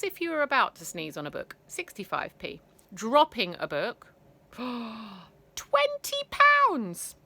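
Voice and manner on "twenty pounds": gasping, very slow aghast; shocked gasp. A narrator's reading. Narrator gasping at the huge fine for children. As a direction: gasp at the price.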